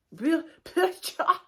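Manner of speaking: high loud burst of laughter; big laugh. Real, not acted. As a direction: laugh it off loudly. Laughs at own retort.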